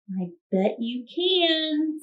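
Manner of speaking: lightly voiced girl, high and slow; light, encouraging girl voice; Vashti encouraging. A narrator's reading. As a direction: gently coax him, a hint of a bright girl. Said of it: "said Vashti".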